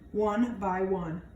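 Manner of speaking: ordinary delivery, nothing marked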